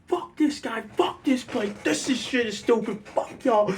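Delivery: high-pitched retold ranting; mock furious rant, not at full volume; going off. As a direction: re-enact a tantrum in a squeezed high voice. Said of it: "like, going off".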